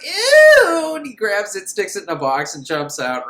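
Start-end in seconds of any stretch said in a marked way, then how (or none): 0.0-1.0 much higher, louder squeal; mock disgusted yelp. Boy seeing yellow fluid, disgusted high squeal. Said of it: "He's like".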